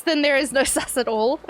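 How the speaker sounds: ordinary talk, nothing marked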